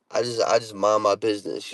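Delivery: ordinary delivery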